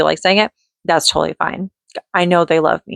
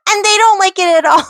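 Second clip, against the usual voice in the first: put-on very high, silly babyish voice; 14 semitones higher, 4 dB louder than usual. Shown as a demonstration. Mom telling how her kids hate it, in high baby voice. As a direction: keep up the put-on baby pitch.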